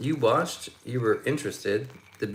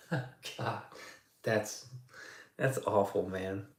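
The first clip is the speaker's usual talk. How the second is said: ordinary talk, nothing marked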